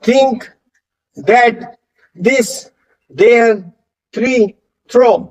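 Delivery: think, that, this isolated, a pause after each; one word at a time from think to throw, TH set out; TH sound drill. Shown as a demonstration. Teacher leading repeat drill, think to throw spaced apart. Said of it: "ऐसे टीएच sound".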